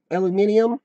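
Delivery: "Aluminium" said the British way, five syllables, al-yoo-MIN-ee-um; "Aluminium" pronounced like a Brit, stress on MIN; British pronunciation. Shown as a demonstration. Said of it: "I think we say it wrong".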